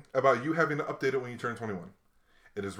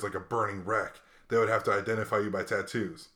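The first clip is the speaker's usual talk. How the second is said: imitating a stern cop, low and measured; lightly voiced tough officer, lower and softer; acting all hard; 6 semitones lower, 4 dB quieter than usual. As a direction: lay it out like a cop trying to be hard. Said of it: "tried to get all hard".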